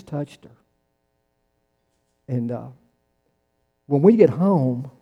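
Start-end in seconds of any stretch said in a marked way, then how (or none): 3.9-4.9 slow and drawn out. Shown as a demonstration. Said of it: "repeated line, voiced differently".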